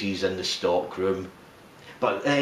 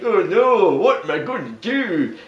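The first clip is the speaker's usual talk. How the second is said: panicked manager; 8 semitones higher than usual. Retold or imitated. Manager panicking about a missing Santa, high mock wail. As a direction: squeal it in dismay like a flustered boss. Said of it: "And Ira's like".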